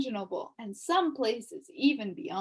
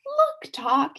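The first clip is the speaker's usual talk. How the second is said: 1 excited cry; 5 semitones higher, 6 dB louder than usual. A narrator's reading. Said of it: "he cried".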